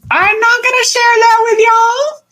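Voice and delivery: very high, teasing sing-song; playful sing-song refusal. Pretend. Host declining to share clients' secrets, playful sing-song. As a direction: refuse in a silly high-pitched voice.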